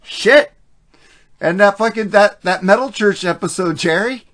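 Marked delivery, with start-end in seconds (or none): 0.0-0.5 exclaiming. Real, not acted. Recalling his slurring on a past episode.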